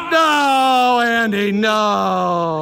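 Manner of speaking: long, high drawn-out "no"; dismayed shout. Real, not acted. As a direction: wail it slowly, sliding down in dismay. Dismay at a play.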